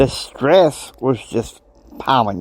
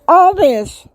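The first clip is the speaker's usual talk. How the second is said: much higher, louder exasperation; exasperated outburst; 8 semitones higher, 4 dB louder than usual. Real, not acted. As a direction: vent the frustration. Venting about squabbling doctors.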